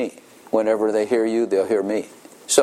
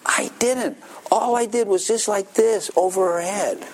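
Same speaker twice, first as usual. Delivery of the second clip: put-on boy defending himself; 9 semitones higher than usual. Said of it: "David said in his defense".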